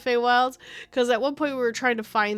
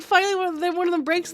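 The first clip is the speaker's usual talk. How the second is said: ordinary talk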